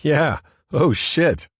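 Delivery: Riley's echo. Acted. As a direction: repeat it back in her own bright pitch. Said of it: "Riley repeated".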